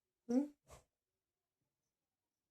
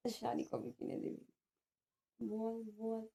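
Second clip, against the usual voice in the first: slow soft whisper, voice dropped away; 9 dB quieter, much slower than usual. Real, not acted. Wife whispers slowly, begging husband for a sari. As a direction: whisper the plea into his ear. Secret ear request for sari.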